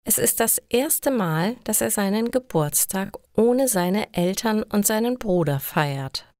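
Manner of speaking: usual speaking voice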